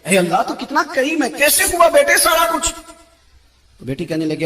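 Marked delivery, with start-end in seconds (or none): 0.0-2.7 high, loud tearful joy; overjoyed cry. Retold or imitated. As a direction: burst out thanking God in wonder. ہم دونوں رونے لگے.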